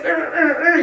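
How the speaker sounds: high, effortful grunts, played up; mimed straining. Shown as a demonstration. Showing the group how not to lift, mock straining. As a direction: imitate frantic pumping with short grunts. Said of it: "I'm not standing there like this".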